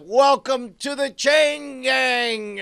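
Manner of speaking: high, drawn-out hammed-up battle cry; battle one-liner. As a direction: stretch it like an action hero's catchphrase. Battle one-liner before lunging.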